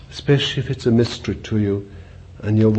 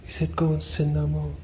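higher, softer incredulous echo; disciples' shocked aside; 5 semitones higher, 5 dB quieter than usual. Acted. Disciples aside repeating what Jesus said, hushed and incredulous. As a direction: repeat the command in hushed, high-pitched disbelief. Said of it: "they went aside and said".

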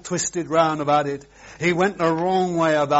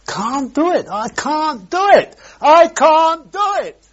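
high, loud, louder with each repeat; building cry; 12 semitones higher, 8 dB louder than usual. Acted. Preacher crying out God's refusal, building loud. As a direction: hammer the refusal harder each time.

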